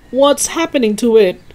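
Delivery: put-on old man watching the chicken change, alarmed; alarmed cry. A narrator's reading. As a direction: panic rising as the legs grow. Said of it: "cried Mister Killikranky".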